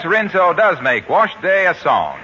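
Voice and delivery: ordinary talk, nothing marked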